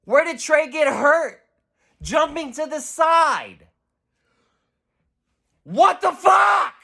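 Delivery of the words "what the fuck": high, loud disbelieving yell; outraged what-the-hell shout; exasperated shout. Real, not acted. Exasperated outburst at play.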